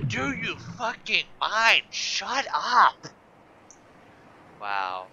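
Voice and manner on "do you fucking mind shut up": louder, higher slowed-down shout; mock angry yell. Gamer yelling at the dehydration alarm, mock furious shout. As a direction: yell at the nagging warning.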